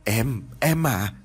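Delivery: tearful pleading. A narrator's reading. Grieving mother begging her husband to see their son, breaking. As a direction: stretch the words out through tears.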